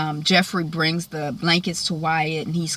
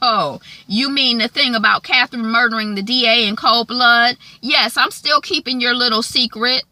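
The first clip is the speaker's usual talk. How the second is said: loud, higher-pitched taunt; 6 semitones higher, 6 dB louder than usual. Retold or imitated. Veronica exposes Cryer in the waiting room, out loud. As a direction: blurt the secret for everyone to hear. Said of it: "she say it out loud".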